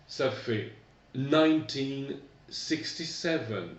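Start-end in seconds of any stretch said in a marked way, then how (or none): 1.1-3.7 "nineteen sixty-seven" said slowly and a little higher; slow teacher model of "nineteen sixty-seven"; model for repetition. Shown as a demonstration. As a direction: year spoken slowly for the class to copy. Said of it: "Répétez après moi".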